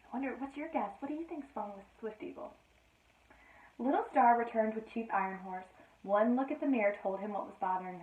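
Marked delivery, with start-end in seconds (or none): none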